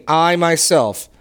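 "I, I myself" slow, every word leaned on; slow down and punch "I" and "myself"; emphasis on I myself. Shown as a demonstration. Teacher points the class to God's emphatic "I, I myself", stressed slowly. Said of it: "don't miss the emphasis in".

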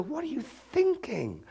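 high, slow mock disbelief; incredulous. Pastor voicing the listener at Adam, incredulous. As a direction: ask it as if the idea is absurd. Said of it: "And you go".

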